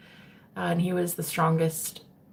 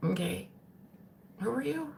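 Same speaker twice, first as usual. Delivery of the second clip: hushed; 4 semitones higher, 12 dB quieter than usual. Retold or imitated. Retelling whispered reaction.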